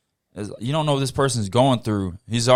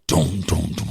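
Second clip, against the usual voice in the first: imitated thud; 8 semitones lower than usual. Imitating the skates hitting the ground.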